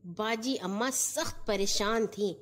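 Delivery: usual speaking voice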